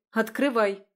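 loud sharp order; commanding. Acted. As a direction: bark the command. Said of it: "скомандовала старшая сестра". (громкая команда)

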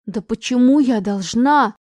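loud, slow, close to crying; near tears. Acted. Daughter refuses to apologise, loud and close to tears. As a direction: protest on the edge of tears. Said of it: "чуть ли не плача спросила Аня".

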